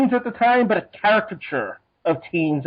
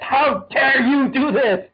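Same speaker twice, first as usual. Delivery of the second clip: mock indignant outrage; 7 semitones higher, slower than usual. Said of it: "And he's like".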